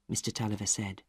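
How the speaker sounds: plain reading voice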